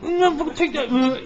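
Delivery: high, put-on struggling voice; acted-out arresting cop. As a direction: act out wrestling the makeup off. Acts out arrest, removing makeup.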